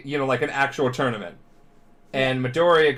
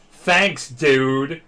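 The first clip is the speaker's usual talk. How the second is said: loud, exasperated mock thanks; sarcastic; 4 semitones higher, 6 dB louder than usual. Classmates to the guest speaker who made them hated, sarcastic. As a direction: lay the sarcasm on heavily and loudly.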